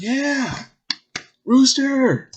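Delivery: high, loud whooping fan cheer; cheering crowd. Acted. Club audience cheering for their host, loud high cheer. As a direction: cheer the host's name like an adoring crowd. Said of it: "everyone's like".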